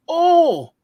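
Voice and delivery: high, loud sudden "oh" of realization; dawning surprise. Retold or imitated. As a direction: let it finally click, loud. Friend finally gets the joke.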